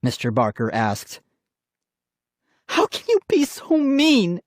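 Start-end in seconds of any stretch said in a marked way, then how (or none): 2.7-4.4 high-pitched hurt wail. A narrator's reading. Sister upset over her doll, wailing. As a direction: wail it at your brother. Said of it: "Molly wailed".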